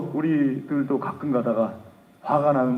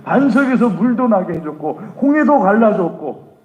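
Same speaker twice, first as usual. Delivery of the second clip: higher, much louder indignant voice building; God angrily listing his miracles to Moses; God's wrath; 5 semitones higher, 6 dB louder than usual. Acted. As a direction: rage at an ungrateful people. God's angry speech in sermon.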